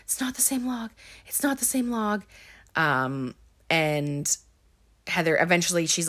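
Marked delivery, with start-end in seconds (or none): 0.0-2.2 high, softened mock-tearful repeat; mildly whiny retold protest; imitated crying repetition. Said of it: "She starts crying".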